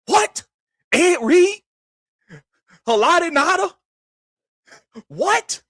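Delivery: high-pitched, incredulous questions. Real, not acted. Host scoffing that the Ravens were just lucky, incredulous. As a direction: squeal each name in disbelief, pausing between.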